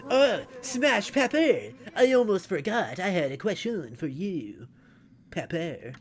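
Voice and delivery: slow, lightly voiced game character. Reads character dialogue aloud.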